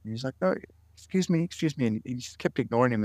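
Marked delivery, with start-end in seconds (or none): 0.4-1.9 pitched up, calling louder for attention; raised calling out. Retold or imitated. Patient in the next bed calling to Rudy, pitched up. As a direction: call out again, pitched up and insistent. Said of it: "And the guy got loud".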